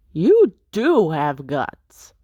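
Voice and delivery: slow, admiring rogue voice; impressed rogue. Acted. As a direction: savor the compliment slowly. Intruder impressed after the hit.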